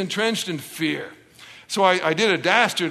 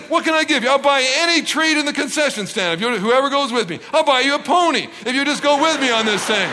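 high, insistent pleading; acted dad begging his kids; desperate bribing; 6 semitones higher than usual. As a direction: bargain harder with every offer. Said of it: "I was getting desperate".

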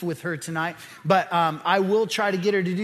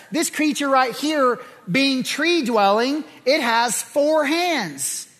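preaching; 4 semitones higher than usual. Acted. Preaching about the Creator.

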